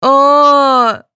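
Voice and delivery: high, loud "aah" wail; childish bawling cry. A narrator's reading. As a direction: let out a tantrum cry. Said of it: "рыдала Светлана".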